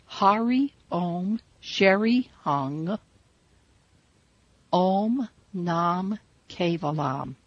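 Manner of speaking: slow "Hari Om Sheri Hung, Om Nam Kevalam", each syllable clear; careful demo of the full mantra; mantra repeated. Shown as a demonstration. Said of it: "I'm going to repeat that mantra".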